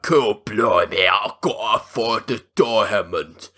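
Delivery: low, hammed-up cockney-style British villain; exaggerated British accent. Shotgun man after the diamond, overblown British accent. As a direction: lay on a terrible fake British accent. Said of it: "the most exaggerated accent ever".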